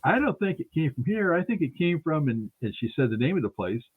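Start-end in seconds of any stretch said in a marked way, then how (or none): 0.0-2.3 pitched up for the lady, a hint of a woman's voice; lightly voiced woman, pitched higher; lightly voiced woman, pitched up as she gives her reading; mimicking the lady. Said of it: "and she's like".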